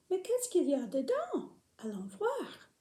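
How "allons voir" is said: whispering. Acted. Whispered suspense before opening egg.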